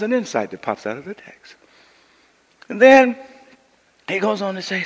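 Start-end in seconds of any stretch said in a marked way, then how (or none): none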